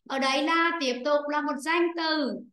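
ordinary talk, nothing marked

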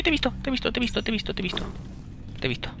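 soft high sing-song, stalking; creepy singsong taunt. Real, not acted. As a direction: tease him softly like a hunter. Acecha a un enemigo. (canturreo burlón y suave)

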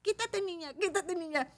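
put-on high child's voice, bossy; little boy. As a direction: imitate a small boy ordering a girl aside. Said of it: "le dijo a Sofi".

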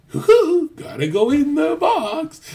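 high giddy hoot, then drawn-out words; high hooting impression of a happy friend; imitating Fresh. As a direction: squeal like a giddy friend heading out to party. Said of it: "Fresh is more like".

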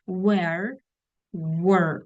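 "Where" and "were" said apart, carefully; careful word-by-word demo of "Where" and "were"; pronunciation model. Shown as a demonstration. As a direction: say "Where", pause, then "were" with its distinct vowel. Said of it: "Ви почули вимову?".

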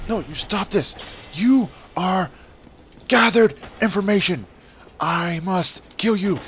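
high, loud, emphatic taunts; mock battle cry. Gamer to enemies he must kill, mock battle shout.

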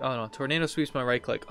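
ordinary talk, nothing marked

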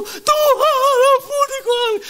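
very high, loud imitated cry to God; hammed-up repentant scream; screaming plea. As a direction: wail out for mercy, stretching the first word. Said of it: "Mereka teriak".

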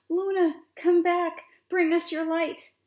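loud, high wind calling out; big, carrying storybook cry; roaring call. A narrator's reading. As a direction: roar it out across the mountains. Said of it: "whispering and then roaring".

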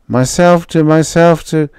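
high, strange voice trailing off; fading delirium. Acted. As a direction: drift off as you faint. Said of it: "he slumped unconscious".

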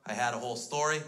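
normal talking voice

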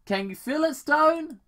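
loud, high, rising thrill; acted villainous excitement. Doctor Robotnik asks Agent Stone if he feels it, excited. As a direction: build eagerly to a loud high finish.

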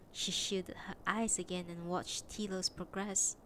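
ordinary delivery, nothing marked

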